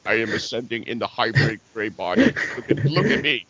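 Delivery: lightly voiced smug little gray alien showing off; mocking hybrid alien. Host mocking souls in the hybrid body, a lightly put-on smug alien voice.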